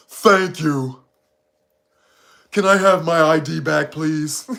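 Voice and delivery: put-on, deliberate polite voice; mock ma'am character. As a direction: play a stiffly polite customer in a put-on voice. Acts the ma'am character replying.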